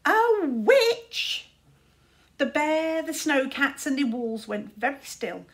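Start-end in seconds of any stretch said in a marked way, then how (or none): 0.0-1.5 high, loud, startled cry; shocked squeak. A narrator's reading. A follower squeaking in fright at the word witch. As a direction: gasp it out in alarm.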